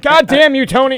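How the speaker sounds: loud high mock curse; fake-furious shout at Tony; mock outburst. Comedian mock-cursing his cohost, loud and high.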